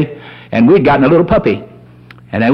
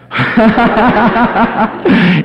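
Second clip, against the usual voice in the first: hearty laugh at his own joke; real laughter; 5 semitones higher than usual. Real, not acted. Preacher laughs after imitating his wife, real laughter. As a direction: laugh out freely after the bit.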